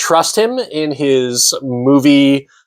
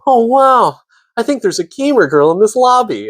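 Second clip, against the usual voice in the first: high-pitched anime gamer voice; anime character voice; 11 semitones higher than usual. Retold or imitated. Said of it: "where he talks like an anime character".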